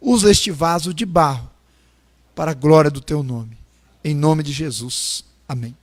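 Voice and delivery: low, subdued prayer voice; closing prayer. Real, not acted. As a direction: bring the prayer down to a humble close. Closes a prayer.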